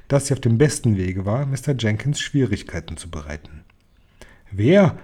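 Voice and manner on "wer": sharp raised question; calling out. A narrator's reading. Father demanding the culprit's name, calling out. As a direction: demand it with a touch of urgency. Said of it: "rief er".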